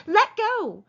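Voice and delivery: high loud cry; cried out. Acted. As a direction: shriek at him to let go. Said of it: "she cried".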